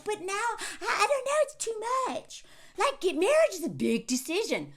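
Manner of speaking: high, whiny mock hesitation; whiny wavering man. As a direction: play a man backing out, whining. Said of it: "these men who are like".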